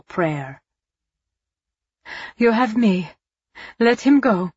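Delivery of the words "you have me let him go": higher, louder desperate plea; cried out. Acted. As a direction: beg him loudly to stop. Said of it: "Eva cried".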